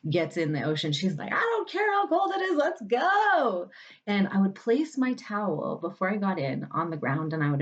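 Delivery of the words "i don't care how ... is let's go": put-on very high little-kid shout; hammed-up excited baby voice; gleeful inner child. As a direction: squeal it like a fearless child charging into the sea. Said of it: "baby [name]".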